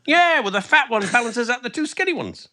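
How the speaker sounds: high, exaggerated sketch voices; mock Pythonesque falsetto; Pope and painter banter. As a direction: ham up the Monty Python bit. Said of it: "describing a painting".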